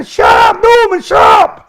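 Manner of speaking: mock guards shouting at Norman; imitated guard yell. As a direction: bellow it at the noisy prisoner. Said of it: "they used to go".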